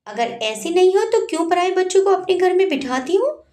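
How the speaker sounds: high-pitched angry challenge. A narrator's reading. Parul challenging the neighbour over feeding others' children, angry. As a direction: fling the accusation as a question. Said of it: "Parul गुस्से में बोली".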